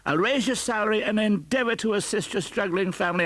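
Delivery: high, loud, hearty generosity; overflowing with goodwill; jubilant Scrooge. Acted. Scrooge happily promising Bob a raise, jubilant.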